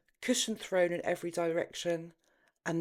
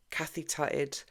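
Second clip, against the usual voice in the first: ordinary delivery, nothing marked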